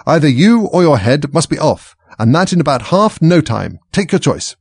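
high, loud furious threat; furious queen. A narrator's reading. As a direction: bellow it like a tyrant queen.

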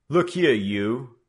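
loud, slow, commanding tough; gang leader's authority. Acted. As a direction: bark it like the boss of a street gang. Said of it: "spoke with the authority of leader".

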